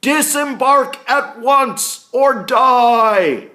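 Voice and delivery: very loud, high, slow bellowed command; Captain Smith shouting at the ship, commanding bellow. Acted. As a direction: bellow across the water. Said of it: "he shouts".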